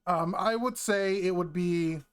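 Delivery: ordinary talk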